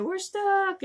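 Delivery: high, loud panicked plea; retelling a scared woman stuck in the elevator, high and panicked; panicked cry. Retold or imitated. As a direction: cry it out like you're trapped. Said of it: "And she said".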